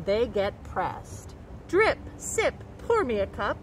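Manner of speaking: slow, lilting picture-book read with long pauses before each ending; reading to children. A narrator's reading. Nature educator reading a water cycle book to kids, in a slow storytelling voice. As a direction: savour each rhyme like a storyteller at circle time.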